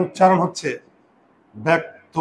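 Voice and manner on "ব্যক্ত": usual speaking voice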